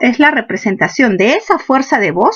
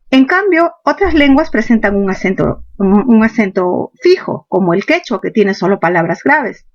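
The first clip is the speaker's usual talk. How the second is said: ordinary delivery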